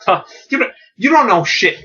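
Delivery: high mocking jeer, a lightly voiced Joker; Joker taunt.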